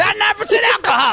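high shouted party line; clip shout. Acted. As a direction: yell it high like a drunk reveler. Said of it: "reenactment of Dub's call".

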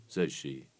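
ordinary delivery, nothing marked

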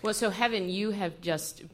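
ordinary talk, nothing marked

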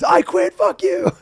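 very high, loud defiant yell; hammed-up quitting yell; mock outburst. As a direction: blow up and shout it. Mock apology turns to outburst.

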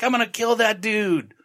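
mock threat. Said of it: "and he's like".

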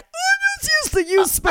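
bright, high and fast mock cheer; hammed-up cheerful exclamation. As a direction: chirp it like a happy mistake. Retold cheerful mistake.

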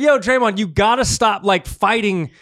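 loud mock scolding of a player; mock league lecture. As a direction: lecture him like an exasperated parent. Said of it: "They're just like".

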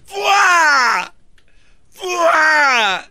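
high, loud, strained yell; shouting fuá. Shown as a demonstration. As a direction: bellow it from the gut. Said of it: "A ver, di como Fermín, fuá".

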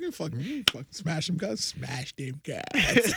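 lightly hammed-up, drawn-out ending; mock catchphrase voice. Quoting her smutty phrases back, lightly hammed-up. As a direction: stretch out the last words with a laugh.

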